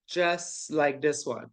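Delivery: "just" stretched and weighted, slow phrase; stress on just. Shown as a demonstration. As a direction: drag out "just like this one" slowly. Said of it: "underline the word just".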